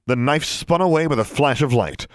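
plain reading voice

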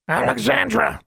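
high-pitched bird voice cooing a name; cooing raven. A narrator's reading. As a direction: coo it like a raven. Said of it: "Charlie cooed".

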